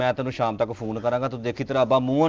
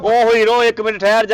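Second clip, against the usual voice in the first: loud, higher call to stop; sharp call; 8 semitones higher, 6 dB louder than usual. Acted. Brother shouts to Hero to wait, loud call. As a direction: halt him with a shout. Drops disguise, stops son.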